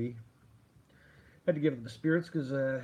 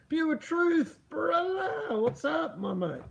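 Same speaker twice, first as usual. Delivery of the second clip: pathetic Scottish accent attempt; 12 semitones higher, slower than usual. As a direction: call out in a badly faked Scottish brogue. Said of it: "my pathetic attempt at a Scottish accent".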